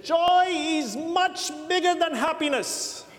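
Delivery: put-on high, slow South African accent; lightly voiced South African accent. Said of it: "It's my mediocre South African accent".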